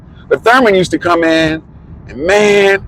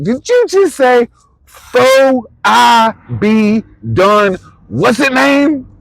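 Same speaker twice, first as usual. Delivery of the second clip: incredulous; 10 semitones higher, much slower than usual. Retold or imitated. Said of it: "I was like".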